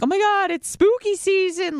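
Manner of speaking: high-pitched, gushing parody; mock radio excitement. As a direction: squeal it like cheesy radio chatter. Mocking typical radio bobo chatter.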